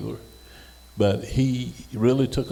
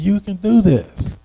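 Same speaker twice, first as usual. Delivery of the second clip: higher, slower and louder, pressing the point; firm insistence; 10 semitones higher, 6 dB louder, slower than usual. Retold or imitated. Instructor urging a doubtful student, firm and emphatic. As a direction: drive it home, slowly.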